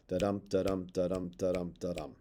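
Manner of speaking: soft da-DUM, da-DUM, stress on each dum; iambic beat. Shown as a demonstration. Teacher showing students the iambic backbeat, soft and even. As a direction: tap out the da-dum rhythm, falling at the end. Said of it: "rhythm ... is iambic pentameter".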